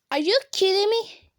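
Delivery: loud, high outraged disbelief. Real, not acted. Gamer yelling at the game in shocked disbelief. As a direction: blurt it at the screen in shock.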